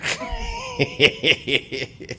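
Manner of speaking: amused wheezy chuckle; short run of breathy chuckles. Real, not acted. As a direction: laugh at your own teasing. Teasing Stan about yawning.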